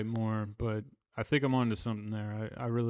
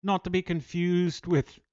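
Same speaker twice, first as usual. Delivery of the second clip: a mock Northern Irish accent, a bit higher and louder; 5 semitones higher, 5 dB louder than usual. Streamer greeting a Northern Irish viewer in a mock Northern Irish accent. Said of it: "I hope you don't find that accent offensive".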